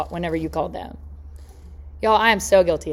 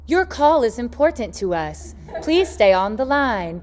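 slow recorded hold-line voice; automated hold message; 2 semitones higher, slower than usual. Retold or imitated. Phone system stalls a waiting caller, slow recorded message voice. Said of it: "And then it says".

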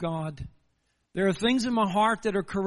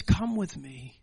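voice of your lover; 5 semitones lower, slower than usual. Retold or imitated. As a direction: call them gently, like a lover. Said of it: "hearing this voice of your lover".

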